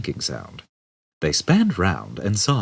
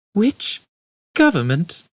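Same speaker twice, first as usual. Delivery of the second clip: much higher, halting question; stuttered; 14 semitones higher, 4 dB louder, slower than usual. Acted. Ford stuttering his question at the robot. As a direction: trip over the words, pitched up. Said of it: "stuttered Ford again".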